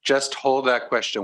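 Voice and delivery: usual speaking voice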